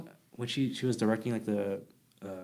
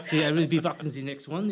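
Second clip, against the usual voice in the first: playful mock French accent; 4 semitones higher, 4 dB louder than usual. Shown as a demonstration. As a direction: say it like a hammy Frenchman. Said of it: "with this French accent".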